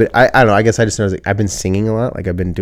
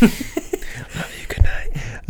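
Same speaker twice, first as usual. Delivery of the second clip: mock whisper; 7 semitones higher, 4 dB quieter, much slower than usual. Playful bedtime goodnight back.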